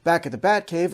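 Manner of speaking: normal talking voice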